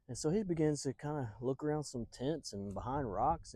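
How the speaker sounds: plain reading voice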